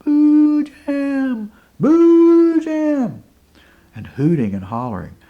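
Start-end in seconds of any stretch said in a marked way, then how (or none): 0.0-3.2 high, loud, long-drawn call; far-carrying holler, vowels stretched high. A narrator's reading. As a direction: holler the name out across the mountains. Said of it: "hollering for him".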